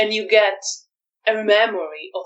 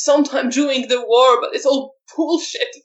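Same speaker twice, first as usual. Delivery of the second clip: high, louder voice on the edge of crying; near tears; 8 semitones higher, 3 dB louder than usual. Acted. Arek answering his interrogator, close to crying. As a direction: hold back a sob, pitch up and strained. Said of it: "almost as if he was about to cry".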